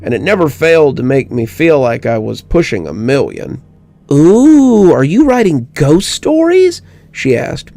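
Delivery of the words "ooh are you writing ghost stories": put-on high, louder, thrilled child's voice; a kid amazed by ghosts; mystified little girl. A narrator's reading.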